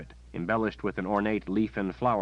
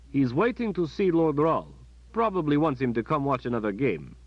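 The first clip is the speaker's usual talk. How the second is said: a higher, louder soldier's voice, friendly; the kindly palace captain; captain's voice; 7 semitones higher, 4 dB louder than usual. Acted. Captain telling Kalen about the waiting boy, friendly.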